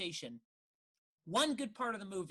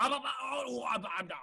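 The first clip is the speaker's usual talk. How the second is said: stammering babble, starting high; a hint of flustered spluttering, imitated; mock babbling; 6 semitones higher, 4 dB louder than usual. Podcaster mimics flustered actor's denial, mock babbling. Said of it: "he just babbles".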